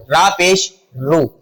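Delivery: slow "را پیش رو", ending on a long roo; name letter and mark, then draw out "رو" as roo; spelling out syllable. Shown as a demonstration. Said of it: "اس کی آواز جو ہے رو کے ساتھ ہے".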